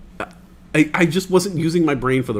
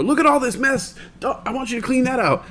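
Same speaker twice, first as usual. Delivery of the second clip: higher scolding mom voice; nagging mom; 11 semitones higher than usual. Retold or imitated. Mom scolding son about messy drawers, high nag. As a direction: mimic the fed-up mother.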